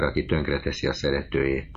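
usual speaking voice

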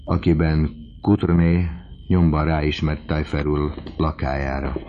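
ordinary delivery, nothing marked

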